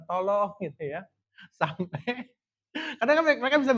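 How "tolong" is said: mild mock call for help; half-voiced retold yell; imitated shout. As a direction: raise the pitch as if calling to shore. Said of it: "terus teriak sama orang di pantai".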